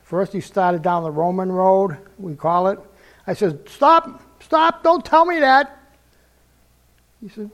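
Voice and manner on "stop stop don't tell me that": high, loud frantic protest; retold shout. Young soldier shouting at the man preaching to him. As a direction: cut him off with a yell.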